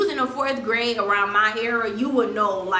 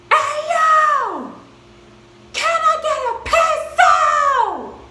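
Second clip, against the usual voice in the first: sudden high, loud holler, drawn out slowly; classroom yell; 7 dB louder, much slower than usual. Retold or imitated. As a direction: shout across the room for a pencil. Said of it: "I yelled".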